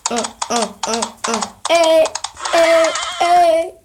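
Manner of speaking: vocal beat. Pretend. Playing along with the horse galloping sound, vocal beat.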